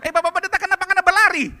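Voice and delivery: high, loud puzzled call; calling after him. Retold or imitated. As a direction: shout after the running pastor. Woman calls after fleeing pastor. (ibu berseru keras memanggil pendeta yang lari)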